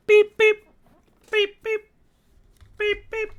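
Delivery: high electronic beeps, fading; high beep beep alarm; beeping alert. Acted. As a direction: chirp it like a ship's console. GM voicing the ship's message alert.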